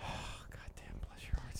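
hushed, voiceless exclamation under the breath; awed whisper. Real, not acted. Host marvels at the couple, in an awed whisper. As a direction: whisper it as if overcome.

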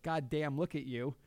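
ordinary delivery

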